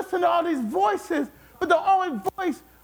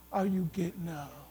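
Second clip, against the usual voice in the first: much lower and softer; hushed question; 10 semitones lower, 11 dB quieter than usual. Acted. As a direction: drop to a low, quiet challenge. Contrasts sitting down with getting up.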